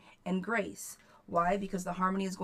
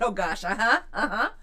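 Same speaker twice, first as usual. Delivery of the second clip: quoting her reaction; 3 semitones higher, 8 dB louder than usual. Retold or imitated. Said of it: "She said".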